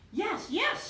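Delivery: high excited yes, yes; crowd shout. Acted. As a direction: cheer it back as the whole crowd. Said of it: "shouted all the circus people".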